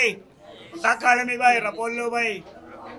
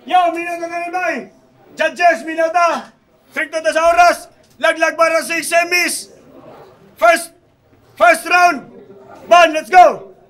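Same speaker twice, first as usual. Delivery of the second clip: hype host; 5 semitones higher, 12 dB louder, slower than usual. Acted. Host calls the round.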